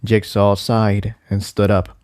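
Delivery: usual speaking voice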